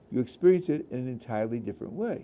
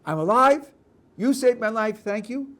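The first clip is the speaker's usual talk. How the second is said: higher and louder, leaning on "alive" and "saved my life"; emphasis on being saved; 9 semitones higher, 9 dB louder than usual. Shown as a demonstration. Rabbi demonstrating one reading, stressing "alive". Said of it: "Is the emphasis on".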